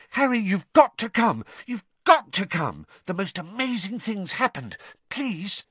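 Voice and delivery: high, urgent, breathless begging; excited pleading. Acted. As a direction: rush him along, eager.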